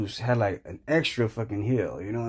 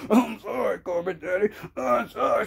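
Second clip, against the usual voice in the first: high mock-crying plea; whimpering apology; 8 semitones higher than usual. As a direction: beg in a pitiful put-on whimper. Beaten conservative begs the billionaire.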